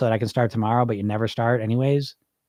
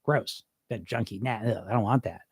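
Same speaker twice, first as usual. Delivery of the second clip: softer, lower grossed-out ugh and bleh; mock disgust; 5 semitones lower, 8 dB quieter than usual. Thinking of a junkie, quiet mock disgust. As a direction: recoil from it like a bad smell, muttered. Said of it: "You, you get a negative".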